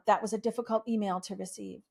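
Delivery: ordinary talk, nothing marked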